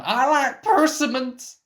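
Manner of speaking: nervous blurt of a random fact. Acted. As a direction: blurt it out of nowhere in panic. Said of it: "I talk about my favourite food when I get nervous".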